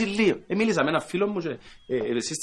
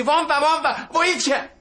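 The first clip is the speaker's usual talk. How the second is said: high mock panic crying βόμβα; panicked yelling; 12 semitones higher, much slower than usual. Girl on the bus shrieks at a bomb scare, mock panic. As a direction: ham up a passenger screaming bomb. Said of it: "άρχισε να φωνάζει".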